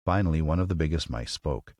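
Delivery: ordinary delivery, nothing marked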